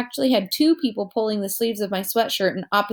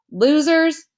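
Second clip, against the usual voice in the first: louder, higher taunting shout; 7 semitones higher, 4 dB louder than usual. A narrator's reading. Henry shouts at the fled bullies, loud taunt. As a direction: shout an insult after the bullies. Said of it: "Henry shouted really loudly".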